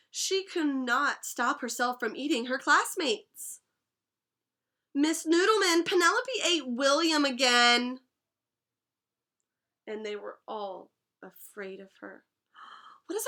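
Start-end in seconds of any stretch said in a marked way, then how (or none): 4.9-8.0 put-on tattling child. A narrator's reading. Tattling classmate in story.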